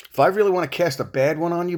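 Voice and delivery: normal talking voice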